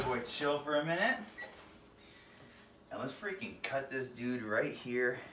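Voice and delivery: ordinary talk, nothing marked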